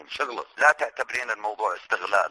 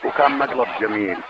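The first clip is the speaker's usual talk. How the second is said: ordinary talk, nothing marked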